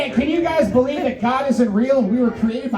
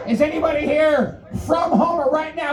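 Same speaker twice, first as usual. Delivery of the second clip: emcee shout; 7 semitones higher than usual. Real, not acted. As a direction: whip up the crowd like an emcee. Emcee hyping the crowd.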